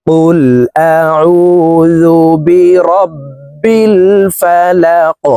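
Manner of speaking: slow melodic verse recitation; Quranic recitation. Shown as a demonstration. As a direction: chant for students to repeat. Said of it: "আমি সম্পূর্ণ আয়াত বলে দিচ্ছি".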